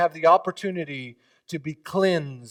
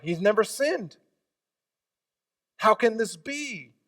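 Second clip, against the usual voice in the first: louder, higher preacher's rise; preaching at full force; 5 semitones higher, 8 dB louder than usual. Acted. Pastor marvelling to his congregation, rising preach. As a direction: let the wonder build.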